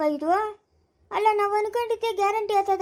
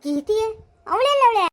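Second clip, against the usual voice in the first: put-on high, loud call to the boy; 13 semitones higher, 3 dB louder than usual. Calling to crying Vihan, loud and high. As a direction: call across to the child.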